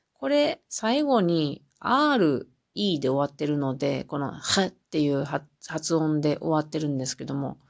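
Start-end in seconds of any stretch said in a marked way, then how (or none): none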